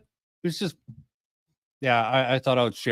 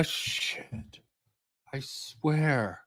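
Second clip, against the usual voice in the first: whispered swearing; 9 dB quieter, much slower than usual. Real, not acted. Swears under breath at clip.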